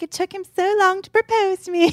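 high-pitched imitation of a British young woman; attempted British accent. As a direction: put on a high, not-quite British voice. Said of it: "I can't do a British accent".

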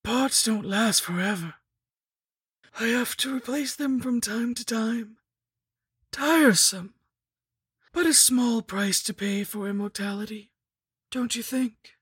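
high, theatrical undead villainess; scheming lich, offhand. A narrator's reading. Lich explaining her immortality, in a high villainess voice. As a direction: talk of ghastly things lightly in a high voice.

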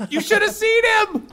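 very high proud gush; proud mom. Acted. Mom bragging to son's friends, high. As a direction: brag about him.

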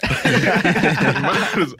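loud, drawn-out words through laughter; laughing. Real, not acted. Laughing with friends over left-hand layups, cracking up. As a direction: stretch it out as you crack up.

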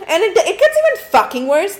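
exasperated. Real, not acted. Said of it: "Like, ugh".

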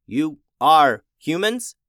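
slow, halting machine speech; robot words, each one separate. A narrator's reading. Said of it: "it spoke in a halting robot voice".

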